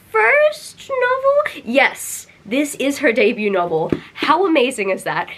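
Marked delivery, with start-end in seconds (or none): 0.1-1.6 questioning. Real, not acted. Unsure about the author's debut.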